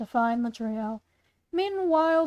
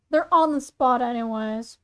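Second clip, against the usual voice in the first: usual speaking voice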